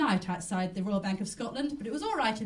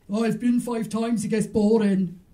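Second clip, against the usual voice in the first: put-on slow, lightly Welsh old lady voice; a hint of a Welsh grandmother, drawn out; Welsh grandmother impression; 6 dB louder, slower than usual. A comic doing her Welsh gran for the crowd, lightly Welsh. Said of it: "I was going to do an impression of her".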